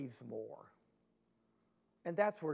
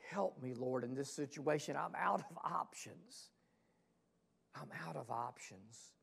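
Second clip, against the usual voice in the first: pleading; 3 semitones lower, 6 dB quieter than usual. Acted. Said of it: "the dad just pleads".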